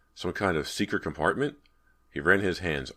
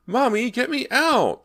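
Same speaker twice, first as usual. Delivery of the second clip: put-on very high, loud, slow child's screech; a trapped boy screeching in terror; screeching little boy; 9 dB louder, slower than usual. A narrator's reading. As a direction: shriek for mommy to get him out. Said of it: "the boy screeched".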